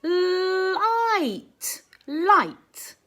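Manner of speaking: Light. sung out long on the I; Light. drawn out, slightly sung; stretched vowel. Shown as a demonstration. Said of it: "Sound it out".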